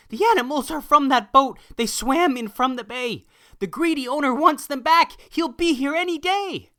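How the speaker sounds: high, loud urgent announcement; breathless news. Acted. Messenger boy rushing in with urgent news. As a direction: run in shouting the news.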